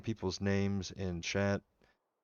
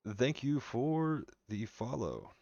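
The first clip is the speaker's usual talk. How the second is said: drawn-out thanks; 4 semitones higher, slower than usual. Real, not acted. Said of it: "thanks follower twice".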